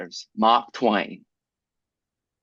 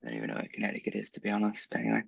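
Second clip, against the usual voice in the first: muttering; 2 semitones lower, 12 dB quieter, much faster than usual. Real, not acted. Embarrassed mumbled admission.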